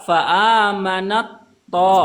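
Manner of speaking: "fa'amanat to" very slow, t folded into to; merged t into to. Shown as a demonstration. Teacher demonstrating idgham of ta into to, slow. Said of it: "Yang kedua".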